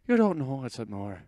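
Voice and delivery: hammed-up Irish woman voice; Irish accent. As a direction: lilt it like a little Irish woman. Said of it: "I sound like a little Irish woman".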